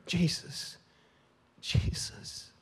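slow, broken whisper of the name; faltering hushed prayer. Retold or imitated. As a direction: barely breathe the words out, stumbling. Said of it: "That was my prayer".

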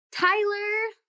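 high, drawn-out call. Acted. Trapped Taylor calls for her twin, calling out. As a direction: call for someone who is out of sight. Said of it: "she called out again".

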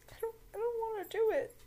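high, fast, whiny protest; reluctant whine. Real, not acted. Podcaster dreads reading the fanfic aloud, high whiny protest. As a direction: beg not to have to read it.

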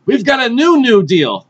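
high, loud rallying proclamation; hammed-up political launch; mock Democrats' announcement. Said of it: "The Democrats go outside and they're like".

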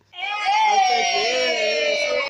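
high shouted cheer; high joyful yell. Real, not acted. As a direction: let out a triumphant cry. Celebration cry.

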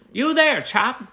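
put-on high, loud old-movie Mid-Atlantic accent. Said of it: "the northeastern accent or whatever".

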